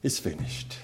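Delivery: breathy, defeated whisper, all hope gone; soft resigned murmur, the way it was first imagined. Shown as a demonstration. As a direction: let it fall away like giving up. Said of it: "I could see resignation".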